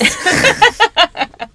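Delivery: high, loud burst of laughter. Real, not acted. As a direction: laugh out loud, caught off guard. Laughs after their one-word game.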